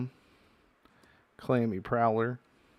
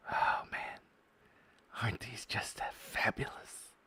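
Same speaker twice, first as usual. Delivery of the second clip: whisper; 9 dB quieter than usual. Real, not acted. Whispering over shiny foil cards.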